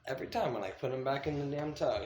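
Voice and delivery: ordinary talk, nothing marked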